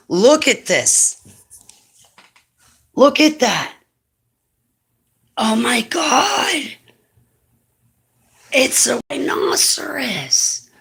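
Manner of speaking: high, slow cooing at the cat. Real, not acted. Talking to the cat, high sing-song baby talk.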